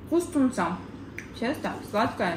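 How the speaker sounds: chewing while talking; talking between bites of bun; mouth full. Real, not acted. Seen 4: She holds the bun, bites at 3.2, then chews through 6.5.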